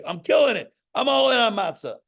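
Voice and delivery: high, loud mock enthusiasm; mock gushing. Rabbi mocking a proud, self-pleased mitzvah doer. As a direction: gush like a show-off.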